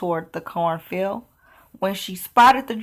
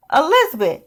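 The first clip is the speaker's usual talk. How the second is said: higher, urgent villager call; 9 semitones higher, 3 dB louder than usual. Acted. Villager warns Elizabeth away from the dragon, higher urgent call. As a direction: call out a warning across the square. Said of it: "one villager called".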